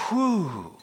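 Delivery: drawn-out, falling "whoo"; imitated awed "whoo". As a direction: mimic a crowd's wowed whoo. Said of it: "and everybody goes".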